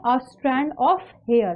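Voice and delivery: very slow, word by word; a strand of hair read out very slowly, each word separate; slow dictation. Shown as a demonstration. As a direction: dictate the phrase slowly to the class. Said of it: "आप लोग क्या बोल रहे होते हैं".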